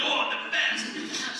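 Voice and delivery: high, slow, breathy swoon; hammed-up praise; mock delight. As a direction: drag it out as if in bliss. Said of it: "it was like".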